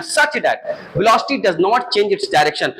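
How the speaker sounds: slow read-aloud. Shown as a demonstration. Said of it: "reading the slide slowly".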